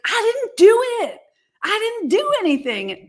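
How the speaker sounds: high, pleading protest of innocence; whiny high denial. Retold or imitated. Spouse to angry partner, high whiny denial. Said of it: "the other half is like".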